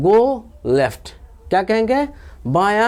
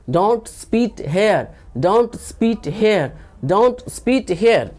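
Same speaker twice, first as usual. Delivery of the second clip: slow, each word set apart; slow drill; 3 semitones higher, much slower than usual. Shown as a demonstration. Teacher repeating the translation for learners, slow drill. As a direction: model it slowly for repeating.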